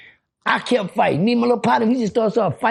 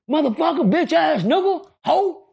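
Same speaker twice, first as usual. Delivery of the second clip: much higher, heated insults thrown mid-fight; furious fight yelling; 10 semitones higher, slower than usual. Retold or imitated. The uncle fighting in the next block, cursing his opponent in high heated yells. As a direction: spit it out like a grown man brawling.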